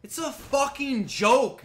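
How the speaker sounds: high, loud, slow furious yell. Real, not acted. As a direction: explode with rage at the screen. Yells the same line.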